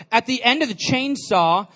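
usual speaking voice

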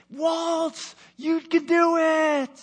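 high, loud cheering call; imagined cheering. Retold or imitated. Preacher voicing his mentor cheering him on.

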